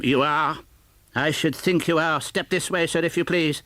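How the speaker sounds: high, loud mock severity; mock stern boss. Scrooge pretending to be angry at Bob, mock stern. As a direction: pretend to be furious.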